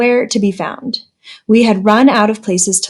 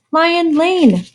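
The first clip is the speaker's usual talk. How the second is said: calling out; 8 semitones higher than usual. A narrator's reading. Answer yelled across zoo.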